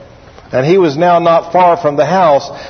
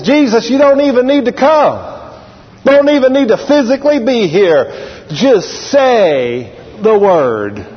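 high-pitched preacher's plea, slowing at the end; dramatised centurion's faith; earnest acted appeal; 6 semitones higher, slower than usual. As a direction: appeal with conviction, stretching the final words. Voices the centurion pleading with Jesus.

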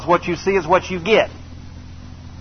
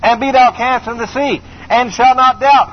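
preaching at full force; 5 semitones higher, slower than usual. Acted. Preacher commanding the mountain before the congregation.

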